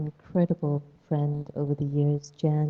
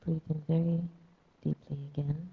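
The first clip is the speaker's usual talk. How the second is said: guided meditation. Acted. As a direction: ease them into stillness.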